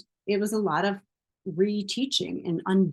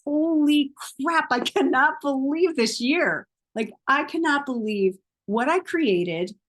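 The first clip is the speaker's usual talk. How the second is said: higher, louder, thrilled disbelief; overjoyed, stunned by the year; amazed delight; 5 semitones higher, 3 dB louder than usual. Shown as a demonstration. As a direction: gush like you can't believe your luck. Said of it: "What would have you saying".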